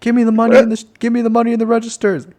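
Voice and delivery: high, loud thug demand; a hammed-up bad guy; mugger voice. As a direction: bark it like a movie mugger. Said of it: "Bad guy".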